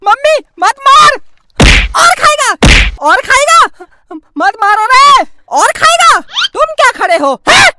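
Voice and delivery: high, loud scolding while hitting; angry scolding. Acted. Mother beating her son, shouting angrily. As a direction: punish with each word.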